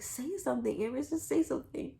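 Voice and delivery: retold prodding. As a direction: prod her insistently to speak up. Retold mother prodding silent daughter.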